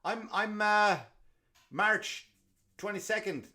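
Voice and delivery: high, loud eager quiz; excited test. Retold or imitated. Teller testing the customer with his own birthday, high and eager. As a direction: fire the date at him eagerly. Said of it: "I go".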